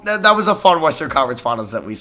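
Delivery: ordinary talk, nothing marked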